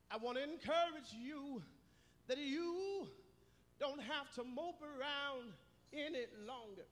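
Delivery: usual speaking voice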